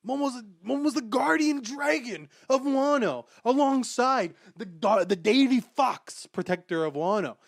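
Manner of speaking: loud, high indignant rant; heated rant. Real, not acted. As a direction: rant it like an outraged fan. Ranting at Vegapunk, very loud.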